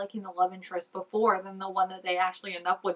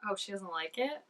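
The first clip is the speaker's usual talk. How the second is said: usual speaking voice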